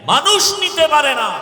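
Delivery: loud, high indignant shout; full-force pulpit bellow; roaring rebuke. Retold or imitated. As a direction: thunder it out like clerics denouncing someone. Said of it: "হুঙ্কার দিয়ে বললেন".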